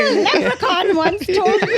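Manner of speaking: high, loud, slow leprechaun Irish; mock Irish accent. Comic mocks friend's impression, exaggerated Irish accent. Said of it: "He's Irish now".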